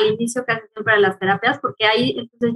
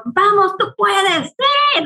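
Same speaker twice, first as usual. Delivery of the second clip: high pep-talk cheer; 8 semitones higher, much slower than usual. Retold or imitated. Mother cheering on her first daughter, high pep-talk voice. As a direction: pump her up like a coach. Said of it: "es como este mo- motivarles".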